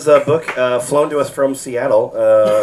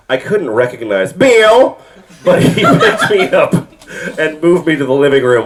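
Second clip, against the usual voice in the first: reading through laughter; 3 semitones higher, 5 dB louder than usual. Acted. Reads book, breaking into laughs.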